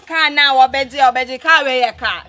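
loud mocking. Sister loudly mocking the boyfriend who has no car. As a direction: shout it out mockingly.

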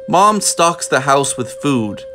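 usual speaking voice

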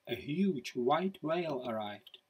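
ordinary delivery

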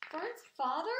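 high, loud, disbelieving wail; sobbed question. A narrator's reading. Pig to the old sheep about Fern's father, sobbed question. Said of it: "sobbed Wilbur".

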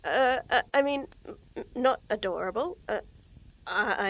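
ordinary delivery

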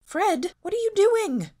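put-on high, loud startled young woman; Daphne startled. A narrator's reading. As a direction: she yelps at the intruder, pitched high. Daphne startled, voiced by narrator.

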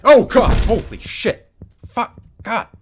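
sudden loud startled yell; scared shout. Real, not acted. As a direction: yelp in fright at a jump scare. Jump scare in horror game.

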